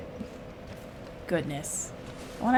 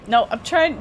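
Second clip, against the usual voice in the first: ordinary talk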